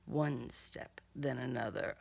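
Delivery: soft, low, hushed; hushed refrain. A narrator's reading. Narrator tracking the killer's steps, hushed. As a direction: murmur each step under your breath.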